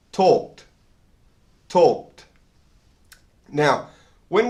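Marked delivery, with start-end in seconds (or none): 0.1-2.2 "Talked" starting and ending on the same T sound, one syllable; model pronunciation. Shown as a demonstration. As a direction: repeat "Talked" slowly, with a crisp final T. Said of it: "the word starts and ends with the same T sound".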